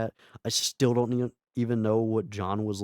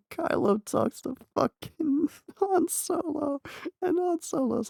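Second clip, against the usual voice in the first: laughing. Real, not acted. Laughing while retelling scene.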